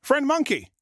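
high storybook shark calling out; shark shouting. A narrator's reading. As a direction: call up into the tree. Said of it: "Then he shouted".

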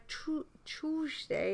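lisping British "Tuesday" as "tuzteɪ"; British accent on "Tuesday". Retold or imitated. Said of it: "you can't even imitate the Brits".

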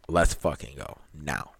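low, slow, quiet challenge; lightly voiced big buff guy; low, slow tough guy. Voicing big buff guys' challenge.

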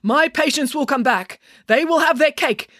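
high, emphatic prediction; defiant insistence. A narrator's reading. Doctor sure his patients will return, insistent.

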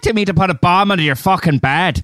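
put-on lightly done Irish accent; mock Irish accent. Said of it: "that sounded Irish".

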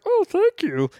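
very high, squeaky-voiced thanks; grateful creature voice. Fan voices the freed creature thanking Mantis, high put-on voice. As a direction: put on a tiny high voice of gratitude. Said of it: "And the guy's like".

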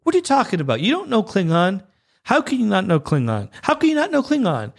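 high-pitched exasperated disbelief; mock incredulous. Fan confronting Uhura over her Klingon, incredulous. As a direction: scold her in rising disbelief.

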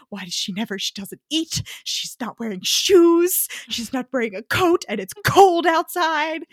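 mock-upset protector listing complaints; fussy stern worrier. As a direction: complain like an exasperated guardian. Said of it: "He's constantly like".

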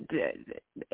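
ordinary talk, nothing marked

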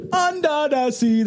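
high sung Jamaican crab imitation. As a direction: sing it like the cartoon crab. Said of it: "that fucking whatever Jamaican crab".

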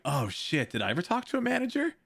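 high, fast alarmed question; suddenly panicked; shocked realization. Retold or imitated. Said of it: "I'm like".

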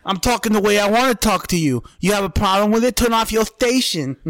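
hammed-up boxer telling off the host; mock Tyson comeback. Friend retelling Tyson's retort to interviewer, mock angry. Impersonating Mike Tyson snapping back.

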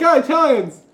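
high, loud groan of "Ah, Italians."; hammed-up exasperated farmer; mock exasperation. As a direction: sigh out the words at the Italians. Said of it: "They're like".

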